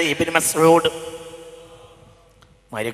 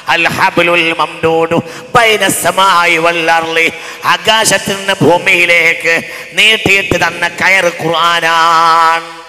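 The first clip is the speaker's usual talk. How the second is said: sermon with slow chanted Arabic; preaching at full force; 5 semitones higher, 12 dB louder, slower than usual. Real, not acted. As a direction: rise to a ringing proclamation.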